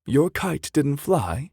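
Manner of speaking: high, slow astonished question; surprised cry. Acted. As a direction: gasp it out in disbelief. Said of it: "cried Anna".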